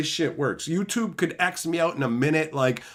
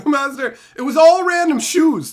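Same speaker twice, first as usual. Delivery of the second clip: high, loud laughing outburst; laughing exclaim; 13 semitones higher, 9 dB louder than usual. Real, not acted. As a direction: burst out laughing in disbelief. Laughing outburst at shoe pic.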